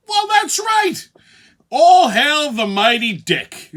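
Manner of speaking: high, slow, hammed-up shout; mock proclamation. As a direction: hail it like a grand herald. Mock proclamation hailing the festival's object.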